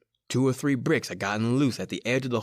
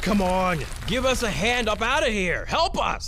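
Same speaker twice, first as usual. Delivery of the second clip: higher and higher frantic shout; desperate yelling; 8 semitones higher than usual. Acted. Trapped man yelling up at the old man for help. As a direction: build from plea to a yell.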